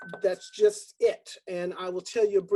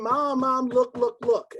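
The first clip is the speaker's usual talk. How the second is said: louder, higher mock child's scream; excited screaming; 4 semitones higher, 5 dB louder, slower than usual. Child begging mother to watch, excited shout. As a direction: call mom over, thrilled. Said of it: "And I was screaming".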